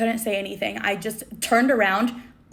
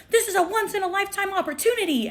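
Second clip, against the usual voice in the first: high-pitched mock whine; annoyed fangirl; 10 semitones higher than usual. Girl arguing for her selfie, mock annoyed squeal. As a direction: imitate an indignant fan, pitched way up. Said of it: "She got annoyed and she was like".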